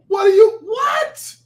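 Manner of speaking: very high, squeaky disbelief; incredulous sputter. Acted. Host baffled at the rapper's excuse, incredulous. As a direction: sputter it out, voice shooting way up.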